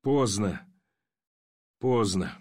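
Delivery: low, understated narrated cry of despair; restrained anguish, pitch sinking; anguished cry of helplessness. A narrator's reading. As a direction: let it fall, low and hopeless. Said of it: "страшным криком бессилия и тоски закричал".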